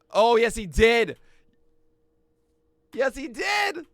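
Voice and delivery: loud, high cheer, slow at first; triumphant shout. Real, not acted. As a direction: cheer the strikeout. Pitcher strikes out batter.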